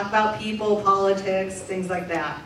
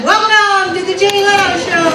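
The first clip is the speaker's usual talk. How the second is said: high, loud showman announcement; host greeting the audience at the top of the show; show host welcome; 9 semitones higher, 9 dB louder than usual. Acted. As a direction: belt it out like opening a late-night show. Opening a talk show live.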